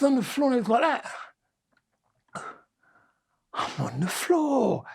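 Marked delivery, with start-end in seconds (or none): 3.5-4.8 slow, stretched-out mock daze; mock dazed realisation. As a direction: drag the words out as if floored. Acts out lying knocked down.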